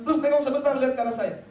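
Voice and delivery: ordinary talk, nothing marked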